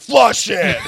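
loud, high shouted demand; mock-horrified holler; disgusted yell. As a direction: shout it like you can't stand it. Acts out disgusted onlookers yelling.

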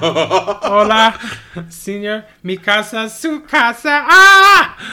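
high, loud comic wailing in Spanish; Spanish teacher being beaten. Retold or imitated. Fan re-enacts the album's teacher beating sketch, mock screaming. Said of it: "severe beating of a high school".

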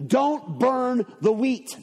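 each word slow and weighty; slowed, emphatic warning; slow solemn command. Retold or imitated. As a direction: lay it down like a divine order. God's command retold, slowed.

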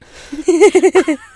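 loud, high burst of laughter; real fit of giggles; helpless laughter. Real, not acted. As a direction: crack up uncontrollably mid-reading. Corpsing while reading letter.

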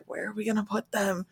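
quiet, worried muttered question; panicked worry. Retold or imitated. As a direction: fret softly about where to put the guests. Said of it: "looking around all panicked".

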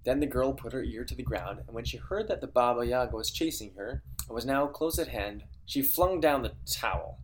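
storybook reading voice; story narration. A narrator's reading. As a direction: read it like a bedtime tale. Reads the fairy tale aloud.

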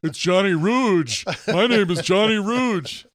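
high, slow, grandly announced name; mock pompous self-introduction. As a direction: announce yourself like a self-important showman. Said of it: "do you get your name right?".

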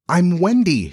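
high, agitated insistence; agitated reminder with a falling end. A narrator's reading. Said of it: "she said agitatedly".

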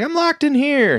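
high, squeaky imitation of a trapped man; mock panicked trapped guy. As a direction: pitch it way up like someone stuck and panicking. Friend retells panicked trapped guy.

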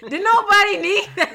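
high, loud, laughing question; laughing disbelief. Real, not acted.

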